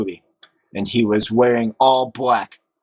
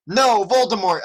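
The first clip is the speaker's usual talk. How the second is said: mock shout; 7 semitones higher than usual. A narrator's reading. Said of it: "I shouted back".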